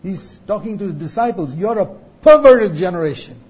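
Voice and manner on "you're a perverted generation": loud, high accusing outburst; preacher's acted, indignant scolding; upset rebuke. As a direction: hurl it straight at the disciples. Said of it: "almost think he's upset with these people".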